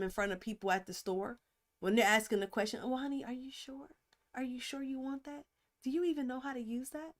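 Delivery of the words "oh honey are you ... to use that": softly spoken acted condescension; mildly belittling. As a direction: doubt him quietly, like a patronizing wife. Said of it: "That's belittling him".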